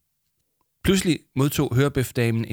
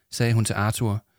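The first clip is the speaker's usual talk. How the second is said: ordinary delivery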